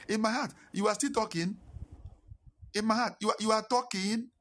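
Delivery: high-pitched, incredulous inner retort; indignant inner thought. Retold or imitated. Inner indignation at being lectured.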